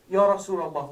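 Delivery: a sudden loud cry of Ya Rasulullah; loud, pleading call to the Prophet; loud plea. Retold or imitated. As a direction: call out Ya Rasulullah loudly, begging. Begging the Prophet in the hospital.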